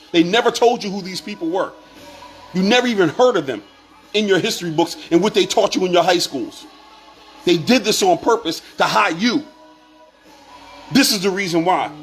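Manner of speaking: ordinary talk, nothing marked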